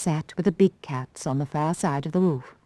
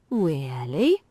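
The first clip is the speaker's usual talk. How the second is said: whispered owl. Acted. Owl whispers its doubt to the boastful doll. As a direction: breathe it out in disbelief. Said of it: "said the owl".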